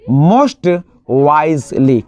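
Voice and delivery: "most wisely" drawn out very slowly; slow model answer. Shown as a demonstration. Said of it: "superlative बनाने के लिए आप इसमें क्या कर देते हैं".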